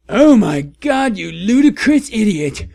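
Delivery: hammed-up outraged yell; angry shout. A narrator's reading. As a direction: scream it at the idiot. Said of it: "They both shooted angrily".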